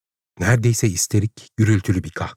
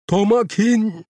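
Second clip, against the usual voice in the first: very high cry of a name; desperate call; 12 semitones higher, slower than usual. A narrator's reading. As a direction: call out running to him. Linda runs to him calling. (çaresizce, çok tiz seslenerek)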